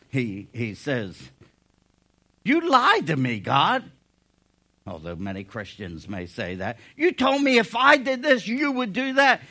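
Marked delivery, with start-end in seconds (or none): 2.4-3.9 accusing cry. Acted. Jeremiah accusing God. 6.9-9.4 raised complaint. Acted. Jeremiah's complaint to God.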